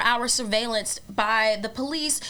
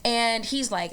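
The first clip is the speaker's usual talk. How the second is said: normal talking voice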